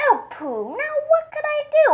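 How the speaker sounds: high, squeaky mouse; little mouse voice. Acted. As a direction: voice a tiny cheeky mouse. Mouse voice in story.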